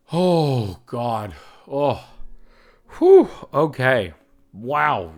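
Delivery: loud overwhelmed gasps and a big whew; shaking off a wave of real emotion; emotional exhale. Real, not acted. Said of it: "Did not expect to get that emotional".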